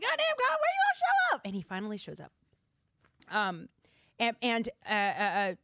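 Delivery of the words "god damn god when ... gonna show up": high, loud exasperated call; impatient cry to God. Retold or imitated. Readers waiting all book, calling out to God high and loud. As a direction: yell for God to finally come.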